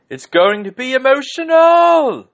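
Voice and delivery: high, loud, slow screamed line; Vinnie Jones scream. Retold or imitated. Host imitating Vinnie Jones, screaming high and loud. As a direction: scream it like a wrestling guest host. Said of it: "And he just screamed".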